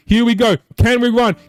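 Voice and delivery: high, excited game call; live play-by-play. Acted. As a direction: call the action like a hyped announcer.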